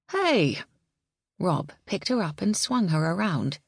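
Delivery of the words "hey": delighted. Acted. Rob hears her big news.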